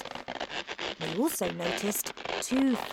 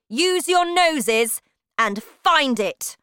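higher, loud, slow witch's order; commanding shout; 6 semitones higher, 7 dB louder, slower than usual. A narrator's reading. Grand High Witch sends witches sniffing, loud slow shout. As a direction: drive the witches on loudly. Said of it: "shouted the Grand High Witch".

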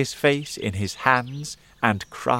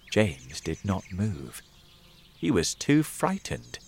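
usual speaking voice

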